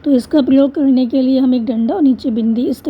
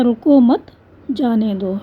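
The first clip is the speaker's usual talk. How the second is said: रुको मत, a clear break, then जाने दो; comma pause after रुको मत; slower than usual. Shown as a demonstration. Teacher shows a comma example, pausing after रुको मत. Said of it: "जब रुके हुए हैं हम".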